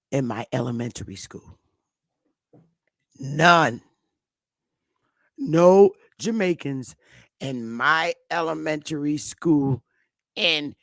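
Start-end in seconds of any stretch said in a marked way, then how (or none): 3.2-7.0 NONE and NO Jamaicans hit loud and slow; emphatic insistence. Real, not acted. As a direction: land each word hard.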